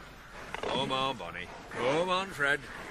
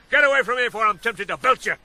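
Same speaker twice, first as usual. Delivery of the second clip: angry threat; 2 semitones higher, 7 dB louder, faster than usual. Acted.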